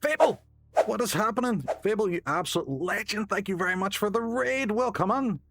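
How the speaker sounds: high-pitched, delighted welcome; excited greeting. Real, not acted. Streamer thanking Fable for the raid, excited. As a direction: hail a friend who just turned up.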